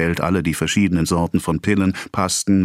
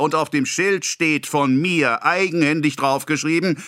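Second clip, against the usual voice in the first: higher, building indignation; accusing lecture; 10 semitones higher than usual. A narrator's reading. Pharmacist lecturing the boy about the poison jar, higher. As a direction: hammer the danger home.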